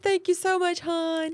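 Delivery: high-pitched mimicked thanks; put-on sweet talk. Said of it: "Y'all know how to talk like that".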